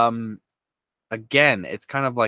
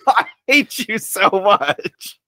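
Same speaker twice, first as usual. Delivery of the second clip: laughing mock complaint; 9 semitones higher, 7 dB louder, slower than usual. Real, not acted. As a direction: laugh through a playful gripe. Playful loss after quiz answer.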